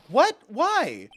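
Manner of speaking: sudden high shocked yelp; shocked what. Acted. Game character startled by announcement, shocked yelp. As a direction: jump at the news, loud and high.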